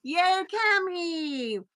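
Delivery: high, very slow drawn-out cheer; cheery welcome. Real, not acted. Welcoming Cami to the live stream, drawn-out cheer. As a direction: stretch out a happy yay.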